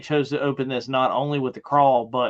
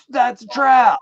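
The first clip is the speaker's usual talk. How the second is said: high, loud cry of alarm; retold warning shout; 11 semitones higher, 10 dB louder than usual. Viewer warning characters on TV, shouted alarm. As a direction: shout it at the screen. Mimics yelling at the screen.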